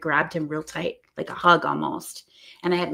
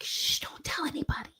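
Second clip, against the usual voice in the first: shushing whisper, pitched higher; playful secret whisper; 10 semitones higher, 8 dB quieter than usual. Retold or imitated. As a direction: hush him like sharing a secret. Keeping fairy secret, shushing.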